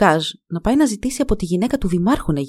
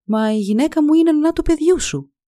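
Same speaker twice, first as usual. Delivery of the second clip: curt; 9 semitones higher than usual. A narrator's reading. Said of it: "της είπε κοφτά ο Λουκάζ".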